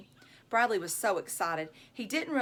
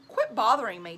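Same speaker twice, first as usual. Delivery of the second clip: usual speaking voice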